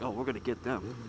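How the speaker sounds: low, soft conspiratorial aside; sneaky plotting. Retold or imitated. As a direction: hush it like you are sneaking up on someone. Plotting to catch couple.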